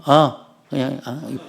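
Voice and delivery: a half-hearted mumbled 이뻐 trailing off; reluctant grudging compliment. Retold or imitated. Husband grudgingly telling wife she's pretty, trailing off. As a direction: force the word out unwillingly. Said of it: "그래서 이렇게 말하면".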